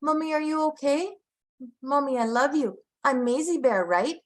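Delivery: put-on high sweet little-kid voice; toddler voice. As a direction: coo it like a charming toddler. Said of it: "he'll come to my face and go".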